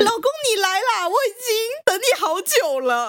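high, gushing coquette; hammed-up lovey greeting, pitched high throughout; sweet-talking beauty. Second beauty greeting him as husband, gushing sweet talk. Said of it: "这个美女讲的第一句话也是".

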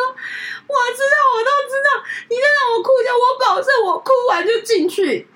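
very high, loud, tearful begging; sobbing plea. Retold or imitated. Senior sobbing outside, promising she'll come in after crying. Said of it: "然后大哭".

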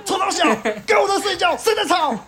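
retold furious shout, very high; furious retort. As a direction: yell back at the neighbor who woke him. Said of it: "我就暴怒".

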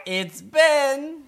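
high, loud cartoon mole; mole telling joke. Mole giving his name in the joke, high and loud.

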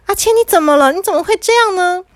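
high-pitched alarm, starting loud; frightened mother. Acted. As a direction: cry out in worry for your child. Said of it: "妈妈这时候吓坏了".

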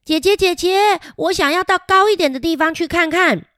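put-on high, eager little boy voice; a small penguin brother begging. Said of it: "哥哥小气就说".

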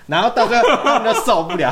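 laughing through the words; giggling as she tells it. Real, not acted. Telling friends about the crowd, laughing.

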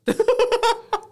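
loud high laugh; high squealing laugh. Real, not acted. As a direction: break into laughter. Laughing at own joke.

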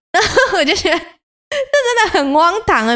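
high, slow, laughing through the words; 笑着说. Real, not acted. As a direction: laugh at how absurd it was. (高声笑着拉长说太荒唐)

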